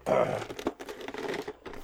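unvoiced rattling noise by mouth; mock Infinity Gauntlet noise; rattling sound effect. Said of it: "I'm gonna try. Hold on".

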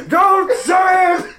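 high, slow, shouted priest warning; a hammed-up old Irish priest shouting. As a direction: yell it like a panicked old priest. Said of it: "this old priest".

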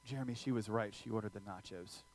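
quiet and low, gently correcting; soft voice of the Lord. Retold or imitated. The Lord speaking softly to Jeremy in the night, low and quiet. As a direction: whisper the truth to him kindly. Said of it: "the Lord whispering to me".

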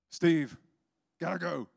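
high, hurried re-enacted call; urgent calling out. Man breaking away from friend Steve, urgent calling out. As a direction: call it out high, already leaving.

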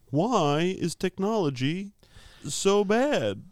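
high, slow, drawn-out complaint; exaggerated high-pitched lament; mock joke delivery. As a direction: whine it slowly like a hammy comic bit. Stand-up style bit, then "that joke.

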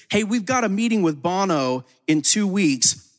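ordinary talk

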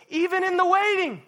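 loud, high preaching cry; passionate cry. Real, not acted. Preacher to church, rising to a loud high cry. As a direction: cry it out over the congregation.

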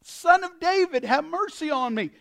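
loud, high plea; yelling out. Acted. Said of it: "And he yells out".

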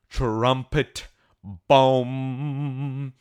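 ordinary talk

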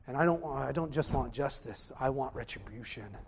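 ordinary talk

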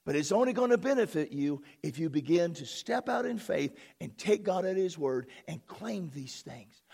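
ordinary delivery

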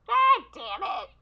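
exasperated outburst at the mic; frustrated curse. Real, not acted. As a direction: curse it out, fed up. Frustrated at bad audio.

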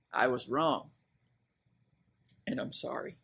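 quiet sincere confession; modelled apology. Shown as a demonstration. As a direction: say it gently for them to repeat. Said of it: "repeat after me".

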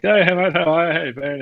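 high, loud hearty hello; cheery greeting. Retold or imitated. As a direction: greet your mate with a big bright hey. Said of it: "And I'm like".

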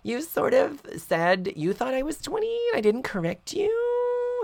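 put-on high, sheepish teen girl voice; girly voice. Kimberly admitting her age, high and sheepish. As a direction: confess it like a coy teenager. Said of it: "she's like".

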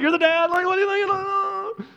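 loud, high incredulous outburst; can't believe this dad; hammed-up disbelief, pitched high; exasperated.

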